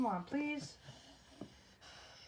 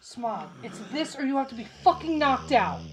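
loud, fed-up ultimatum; real frustrated outburst; exasperated warning; 11 dB louder than usual. Real, not acted. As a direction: lay down the law to the pet.

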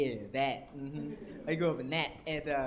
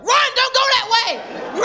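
panicked warning; 11 dB louder than usual. Retold or imitated. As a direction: scream a warning to run. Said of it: "he was like".

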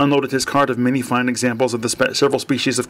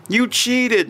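accusing; 11 semitones higher than usual. Acted. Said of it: "Mercedes accused her husband".

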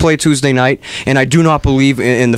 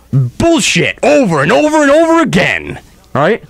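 high, swooping, emphatic complaint; fed up and raising the pitch; exasperated rant; 6 semitones higher than usual. Real, not acted. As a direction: rant with each repeat hit hard. Host rants about failing show.

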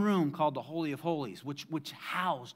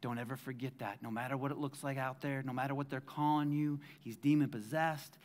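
usual speaking voice

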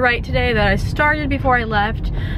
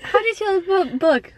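mock teacher question, pitched up; playing the professor; 8 semitones higher than usual. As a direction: pretend professor asking the class, much higher. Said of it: "I'll be the professor".

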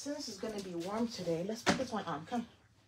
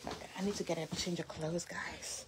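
hushed, fast whisper; 4 semitones lower, 7 dB quieter than usual. Real, not acted. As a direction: whisper an aside to viewers. Aside to viewers, child nearby.

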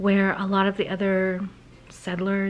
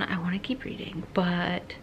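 whispering; 7 dB quieter than usual. Real, not acted. Tempted to keep reading.

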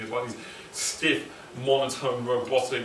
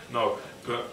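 ordinary talk, nothing marked

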